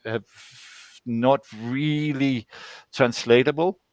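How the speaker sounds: ordinary delivery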